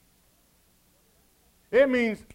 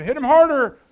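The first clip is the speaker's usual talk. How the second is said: mock yell; 4 semitones higher, 9 dB louder than usual. Church kid yelling during imagined fight.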